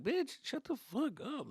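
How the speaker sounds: high, slow mock-irritated retort; snappy comeback. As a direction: draw out a high, sulky retort. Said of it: "I'm like".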